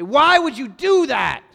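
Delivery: loud, high, slow exasperated scolding; a father screaming at his kid in frustration; mock angry yell. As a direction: yell it at the child like a furious father. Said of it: "I'm never gonna yell at you and scream at you and say".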